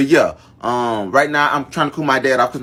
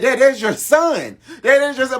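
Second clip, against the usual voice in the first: put-on child pleading with father about his son; 12 semitones higher than usual. Pleading with dad after fight.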